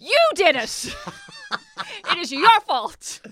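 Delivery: high, loud, shouting accuser; mock Spicer sketch. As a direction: yell the blame like McCarthy's Spicer. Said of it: "Melissa McCarthy, who's doing Sean Spicer".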